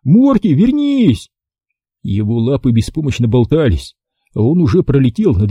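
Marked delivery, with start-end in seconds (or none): 0.0-1.3 high-pitched urgent plea; crying out. A narrator's reading. Boy calls dog back from the sky, crying out. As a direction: call out high, pleading for a return. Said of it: "кричал я".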